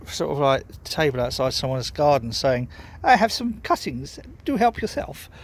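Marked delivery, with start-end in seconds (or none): 3.0-5.3 high, plummy posh English lady; mock-posh English accent as if the sign spoke. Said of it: "didn't actually say that with that accent".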